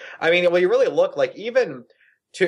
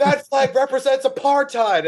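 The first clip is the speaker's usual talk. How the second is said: higher, preachy mock proclamation; mocking protester leader; 11 semitones higher than usual. Mocking the scrawny leftist leading the chant, high mock proclamation. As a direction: parody a self-righteous activist announcing. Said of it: "and he goes".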